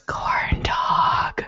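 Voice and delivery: whispered corn dog. Pretend. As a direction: breathe it as a whisper. Whispered tease.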